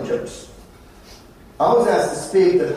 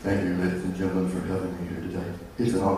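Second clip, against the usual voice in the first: much lower, dull droning lecture voice; stiff formal speech; 9 dB quieter, faster than usual. Shown as a demonstration. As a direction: drone like a boring keynote speaker. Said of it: "You imagine me coming here?".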